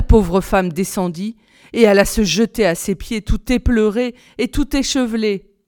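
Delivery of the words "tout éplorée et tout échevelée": usual speaking voice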